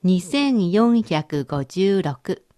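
each number said very slowly; slow dictation. Shown as a demonstration. Teacher reading a number slowly for the learner to translate. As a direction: read it out slowly so they can catch it. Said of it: "続いて、四桁の数字です".

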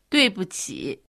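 sorry-sounding, contrite 对不起; teacher demonstrating a contrite apology; apologetic. Shown as a demonstration. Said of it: "申し訳なさそうに言ってみてください".

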